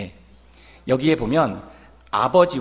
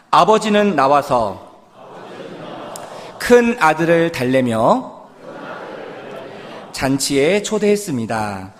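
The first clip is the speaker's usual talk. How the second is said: slow, louder line for the congregation to echo; call for repetition; 4 dB louder than usual. Shown as a demonstration. Preacher leading the congregation in a repeat-after-me line. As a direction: spell it out slowly so they can repeat. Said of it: "저를 한번 따라해 주시기 바랍니다".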